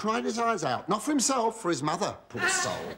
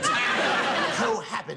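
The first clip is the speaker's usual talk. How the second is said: Cohabit drawn out slowly and relished; dreaded word savoured; much slower than usual. Acted. Host savouring the naughty word, slowly drawn out. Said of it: "That dreaded seven-letter word".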